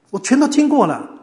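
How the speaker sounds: high, loud exclamation falling away; emphatic insistence. Retold or imitated. Host to would-be evangelist, emphatic insistence. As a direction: insist you know it all already.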